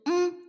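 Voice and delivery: small high, quiet hum; hum of assent. A narrator's reading. Wife answers her husband with a meek hum. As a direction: hum a meek little yes. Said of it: "അവൾ മൂളി".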